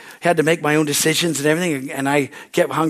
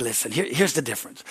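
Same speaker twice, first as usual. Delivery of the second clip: quiet; 3 semitones lower, 7 dB quieter than usual. Retold or imitated. Pastor lowers voice to explain.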